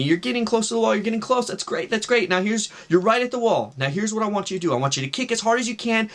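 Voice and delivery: lightly acted encouraging instructor; retold coaching voice. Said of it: "the voice is telling me".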